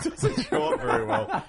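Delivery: can't help laughing while saying it; amused, laughing, voice shooting up. Real, not acted. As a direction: talk through high-pitched laughter. Amused that shirt logo won't show.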